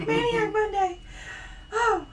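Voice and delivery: high, giggly and spent; laughing. Real, not acted. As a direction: say it through leftover laughter. Still laughing at the retake.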